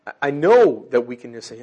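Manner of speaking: ordinary delivery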